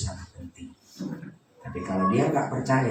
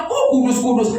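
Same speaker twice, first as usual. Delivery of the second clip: mocking; 14 semitones higher, 8 dB louder than usual. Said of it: "Saya dulu menghina Pak Deki".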